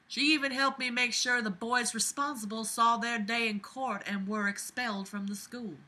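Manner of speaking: put-on Southern belle accent; Abby, Southern belle. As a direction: keep up the Southern belle letter voice. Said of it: "my Southern Belle accent".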